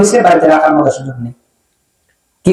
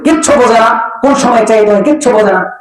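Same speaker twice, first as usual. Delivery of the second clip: higher-pitched mock scolding; scolding son; 5 semitones higher than usual. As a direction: imitate a son rebuking his mother. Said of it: "মাকে ধমক দিয়ে ফেললেন". (ছেলের ধমকের নকল, চড়া সুরে)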